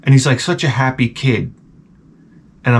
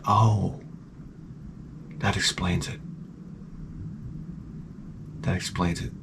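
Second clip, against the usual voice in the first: low, very soft dry reply; deadpan aside; 6 semitones lower, 15 dB quieter than usual. Retold or imitated. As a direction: drop it to a resigned undertone. Deadpan reply to the cheery kid.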